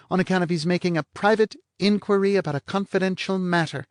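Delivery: parroting his words back to mock him; mocking blonde. Blonde mocks the detective's phrase to Lou, bright and high.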